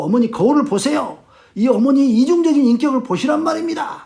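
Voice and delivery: rising, insistent confrontation; acted-out son pushing mother hard; pressing accusation. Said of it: "막 그러면서 막 몰아붙여요". (점점 높아지며 몰아붙이는 말투)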